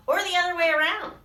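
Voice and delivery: excited little monster; eager child. Acted. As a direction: blurt it out happily. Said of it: "said Little Monster".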